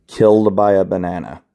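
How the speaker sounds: ordinary talk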